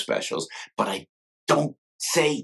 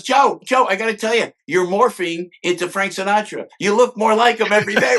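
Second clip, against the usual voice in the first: Tom Hanks teasing; 9 semitones higher, 4 dB louder than usual. Retold or imitated. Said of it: "He go, he goes".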